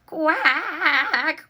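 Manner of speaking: loud high startled quack; duck quack. A narrator's reading. Missus Mallard quacking as she tumbles back. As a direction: squawk it like a frightened duck. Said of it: "went Missus Mallard as she tumbled back again".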